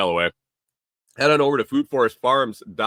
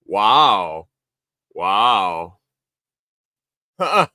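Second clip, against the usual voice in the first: mock offended; 7 dB louder, much slower than usual. Real, not acted. Reacting to a chat dig.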